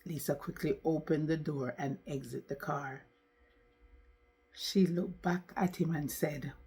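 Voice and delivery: ordinary delivery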